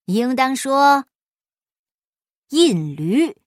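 slow, loud, lordly correction; smug correction. Acted. Guan smugly correcting Afanti's word, slow and lordly. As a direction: lecture him grandly. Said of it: "关老爷得意忘形地说".

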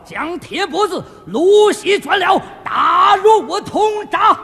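loud, high theatrical command; judge's execution order. Acted. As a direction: declaim the sentence, slowing at the end.

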